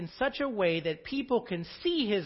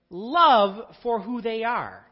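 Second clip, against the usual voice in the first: louder, slower, hammering the word love; full-force preaching; 8 dB louder than usual. Real, not acted. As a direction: preach it out to the congregation.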